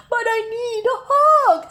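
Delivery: high, loud whiny plea; begging near tears; pleading cry. Acted. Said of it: "said Douglas".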